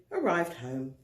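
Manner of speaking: usual speaking voice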